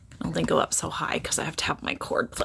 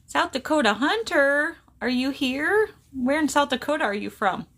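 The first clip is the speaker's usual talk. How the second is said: ordinary talk, nothing marked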